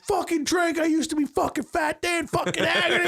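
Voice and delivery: high mocking dad rant; mock drunk dad. Mimicking a drinking-buddy dad, high and mocking. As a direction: ham up a boozy dad pushing drinks. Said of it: "imagined dad line".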